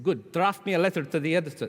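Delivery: loud, quick, high bad Scottish; poorly imitated Scottish boss; attempted Scottish accent. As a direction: bluster through a botched Scottish accent. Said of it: "That sounded really crap".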